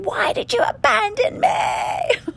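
high, slow pleading cry at the medicine; anguished scream. Retold or imitated. Friend screaming her pain at the medicine, high and slow. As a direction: scream the question up into the air. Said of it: "she starts screaming at the medicine".

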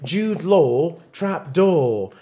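"Jude Law trap door" slowly in an English accent, no R in "door"; English non-rhotic "Law" and "door". Shown as a demonstration. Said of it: "if you're, if you're English".

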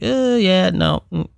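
high-pitched mock reluctant no; imitating workplace refusal. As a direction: hem and haw high, like an employer declining. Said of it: "work is like".

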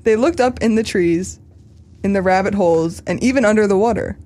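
put-on earnest storybook voice for children; solemn read-aloud of a kids' tale; children's story reading. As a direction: read it like to an auditorium of kids.

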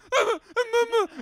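short high giggle; a quick high-pitched laugh. Real, not acted. Laughing while retelling nightmare.

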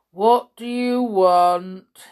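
slow, loud gloomy demand. A narrator's reading. Soldier stops a visitor at the castle, gloomy. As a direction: ask it gloomily, drawing the words out. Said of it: "he asked gloomily".

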